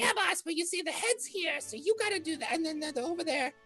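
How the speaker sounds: very high, thin little imp voice; a servile imp squeaking at its boss; squeaky imp. Acted. Imp pleads with the Rakshasa, squeaking high. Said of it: "the imp's just like".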